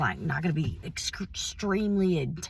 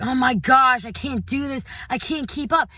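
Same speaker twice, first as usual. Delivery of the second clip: panicked self-talk; 5 semitones higher, 4 dB louder than usual. Retold or imitated. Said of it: "talking like that".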